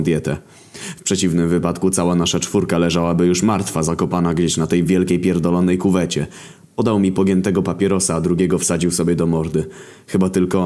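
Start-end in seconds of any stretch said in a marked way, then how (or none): none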